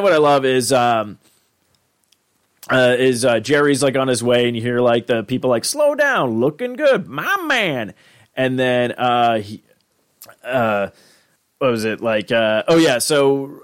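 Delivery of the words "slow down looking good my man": high cheesy catcalls; hammed-up townsfolk. Simulation bystanders hyping Jerry on his way home. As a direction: call out in a high canned NPC voice.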